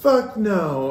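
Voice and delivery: high put-on voice of a refusing son; sassy son. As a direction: dismiss dad in a mock high voice. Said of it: "He's like".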